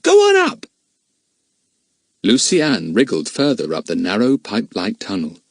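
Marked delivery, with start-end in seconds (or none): 0.0-0.7 loud, high call. A narrator's reading. Dinah calling up to Lucy Ann, loud and high. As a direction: call up the hole.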